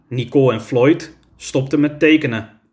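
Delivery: plain reading voice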